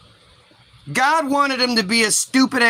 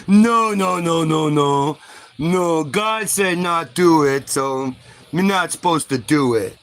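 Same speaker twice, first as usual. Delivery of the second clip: slow, drawn-out caveman voice, sinking lower; simple-minded refusal; 4 semitones lower than usual. Acted. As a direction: drag out each no like a dim cave-dweller. Primitive Eve refusing the snake.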